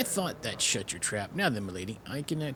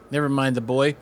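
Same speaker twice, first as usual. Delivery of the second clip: put-on louder, brushing the boy aside; loud dismissal; 7 dB louder than usual. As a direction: wave the boy off.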